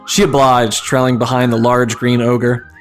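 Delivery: usual speaking voice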